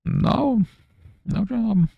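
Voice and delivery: squeaky high no job; mock deadpan reply. Jobless applicant answering a nosy acquaintance, squeaky high. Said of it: "I'm like".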